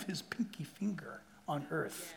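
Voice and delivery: whispered, breathy with awe; hushed whisper. Acted. Preacher hushing the congregation about God's power, whispered. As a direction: drop to a whisper for the pinky finger.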